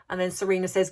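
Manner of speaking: normal talking voice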